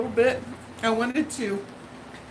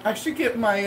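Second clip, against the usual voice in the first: ordinary talk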